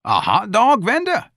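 high, rising incredulous question; croaked disbelief. Acted. Santa repeating Irene's news, incredulous. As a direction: echo the news in shock, tune shooting up. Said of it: "Santa croaked".

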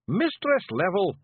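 high Feegle scream, slowed. Acted. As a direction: yell to rouse her. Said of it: "Rob screamed".

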